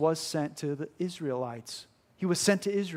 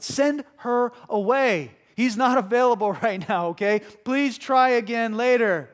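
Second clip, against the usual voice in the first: acted dismissal; 6 semitones higher, 7 dB louder than usual. Preacher voicing disciples sending the woman off, loud dismissal. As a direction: turn her away firmly and loudly.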